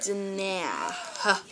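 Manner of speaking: backwards speech. Shown as a demonstration. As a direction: hand said backwards as dna-ha, soft. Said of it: "I think I did that wrong".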